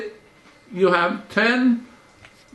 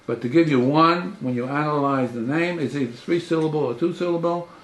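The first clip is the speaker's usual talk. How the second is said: ordinary talk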